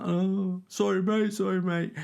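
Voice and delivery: high, fawning sorry mate; grovelling apology. Retold or imitated. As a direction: suck up to him, voice way up.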